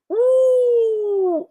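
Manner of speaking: loud, very high drawn-out whoo; sustained high hoot of reaction; long whoo. Real, not acted. Reviewer reacting to this dude, high whoo.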